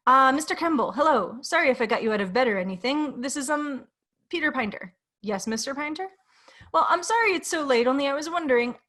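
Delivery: eager and flustered on the phone; nervous man voice. Acted.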